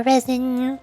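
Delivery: ordinary talk, nothing marked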